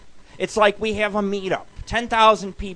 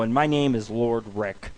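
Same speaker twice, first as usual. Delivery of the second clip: ordinary talk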